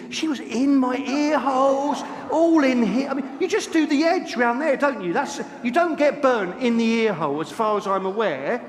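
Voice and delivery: loud, high, outraged comic rant; indignant rant. Real, not acted. Preacher telling congregation about ear massage, loud comic indignation. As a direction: protest to the congregation in mock outrage.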